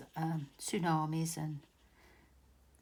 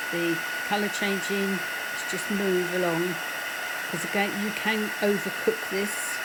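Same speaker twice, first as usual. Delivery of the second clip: raised voice over a loud heat gun; talking over noise; 9 dB louder than usual. Real, not acted. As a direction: project loudly over the machine noise. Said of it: "Sorry about that noise".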